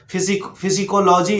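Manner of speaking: फिजिकोलॉजी with the P sounded as F; wrong pronunciation. Shown as a demonstration. Teacher voices what puzzled learners expect, mispronunciation. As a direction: read psychology by its spelling as फिजिकोलॉजी. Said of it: "जो कि बिल्कुल गलत है".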